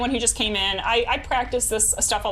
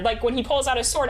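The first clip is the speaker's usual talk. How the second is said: usual speaking voice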